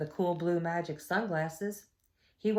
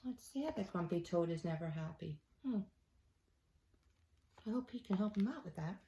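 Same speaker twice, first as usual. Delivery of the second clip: put-on soft, quicker aside to the kids; quiet aside; 8 dB quieter, faster than usual. Reader sharing a quiet thought with the kids, softly. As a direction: lean in and murmur to the listeners.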